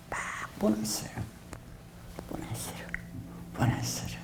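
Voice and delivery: 보너스를 repeated in a fading whisper; a teasing whisper, savoring 보너스를; whispered echo. Shown as a demonstration. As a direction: let 보너스를 die away to a breath, whispering it over and over. Bonus echoed in whisper.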